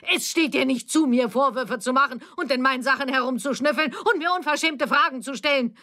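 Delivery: high-pitched, outraged scolding; indignant rebuke. A narrator's reading. Mother tells off her prying daughter, indignantly.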